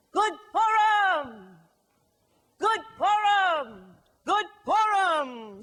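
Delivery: slow ringing holiday shout; loud joyful greeting. Shown as a demonstration. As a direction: boom it out like a holiday cheer. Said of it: "the Rebbe gets ready and he says".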